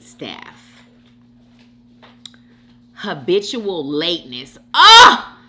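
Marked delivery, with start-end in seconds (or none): 4.7-5.4 high, loud groan of annoyance; sharp loud exclamation; exasperated outburst. Real, not acted. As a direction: burst out, fed up. Groans at habitual lateness.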